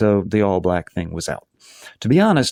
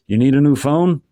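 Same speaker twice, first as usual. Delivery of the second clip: louder, higher questioning call; 6 semitones higher, 5 dB louder than usual. A narrator's reading. Uncle offering niece a phone from the kitchen, calling out. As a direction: call the question after someone leaving. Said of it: "Mike calls to her from the kitchen".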